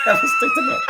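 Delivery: loud laughing cry, pitched far up. Real, not acted. Telling the host about Carlin's note, howling with laughter.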